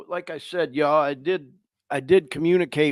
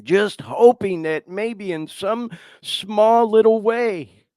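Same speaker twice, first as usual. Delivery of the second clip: ordinary talk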